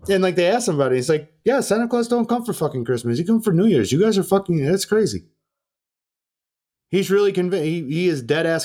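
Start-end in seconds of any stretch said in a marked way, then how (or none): 1.4-5.3 much higher and faster, indignant mock rant; mock outraged foreigner. Host mimicking Florian scolding the producers about Santa, high indignant rant. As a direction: squeal it like a guy outraged at a silly question.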